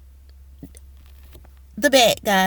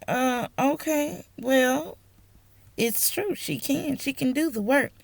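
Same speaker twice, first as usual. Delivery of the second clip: high, soft, tentative reply; hesitant pushback; 5 semitones higher, 3 dB quieter than usual. Retold or imitated. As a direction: answer gingerly but stand your ground. Acted awkward reply to scolding.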